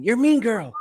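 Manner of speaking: high-pitched imitated taunt; mock accusation. Host voices people judging the young actress, mock accusation. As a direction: mimic a name-caller, voice pitched right up.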